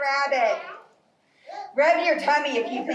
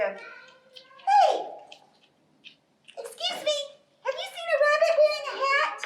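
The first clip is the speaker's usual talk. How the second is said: much higher, quick squeaky squirrel; 13 semitones higher than usual. Acted. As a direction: chirp it fast like a tiny squirrel. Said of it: "squirrel teeth and little squirrel arms".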